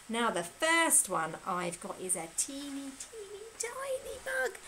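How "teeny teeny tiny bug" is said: very high, small, soft voice; teeny-tiny voice. Pretend. Presenter shows children a tiny bug, teeny-tiny high voice. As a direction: squeak it small to show tininess. Acting out the bug's tiny size.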